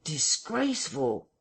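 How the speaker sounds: slow, lightly voiced villager repeating it; echoing eavesdropper. A narrator's reading. Henfrey repeats what the vicar said within, drawn-out echo. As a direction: repeat it slowly, as overheard news.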